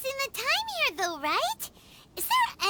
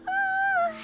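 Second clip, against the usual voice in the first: high, woozy wail; queasy moan; 10 semitones higher, 3 dB louder than usual. Acted. Paimon after downing the odd milk, queasy high moan. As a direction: let out a high, sickly moan.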